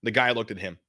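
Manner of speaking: ordinary talk, nothing marked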